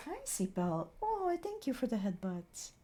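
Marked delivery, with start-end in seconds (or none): none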